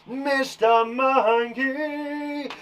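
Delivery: very slow, high, drawn-out introduction; slow showman announcement; announcer voice. Acted. Grand introduction of the puppet.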